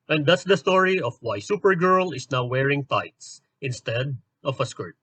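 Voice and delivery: ordinary delivery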